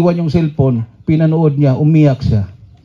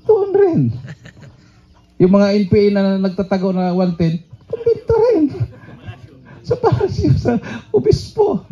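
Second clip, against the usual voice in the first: pitched way up, half laughing at the claims; high mocking answers; 5 semitones higher than usual. Preacher mocking where the NPA hide arms, high and laughing. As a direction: squeal out each hiding place in mockery.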